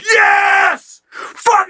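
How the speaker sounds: high-pitched mock crowd yell; cheering crowd. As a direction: yell like a crowd going wild. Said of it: "and the crowd's like".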